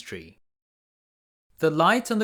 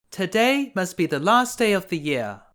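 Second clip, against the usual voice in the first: put-on girl's voice; 6 semitones higher than usual. A narrator's reading. Girl speaks aloud to herself.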